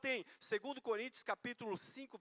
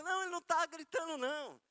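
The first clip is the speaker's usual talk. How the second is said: higher-pitched retold protest; imitating wife's protest; 9 semitones higher, 4 dB louder than usual. Wife insists from the other end of the phone, higher, imitated protest. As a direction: mimic a wife cutting in to calm things down.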